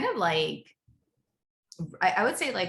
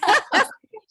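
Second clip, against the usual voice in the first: loud burst of laughter; 11 dB louder than usual. Real, not acted. Laughing at the joke.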